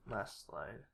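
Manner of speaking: ordinary talk, nothing marked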